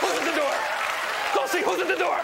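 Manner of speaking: high, excited alarm; teasing the dog. Pretend. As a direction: rile the dog up. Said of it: "I leave the dog messages".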